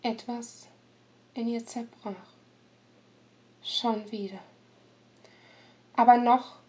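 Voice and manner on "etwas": ordinary delivery, nothing marked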